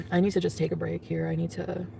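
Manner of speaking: ordinary delivery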